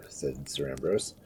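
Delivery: ordinary delivery, nothing marked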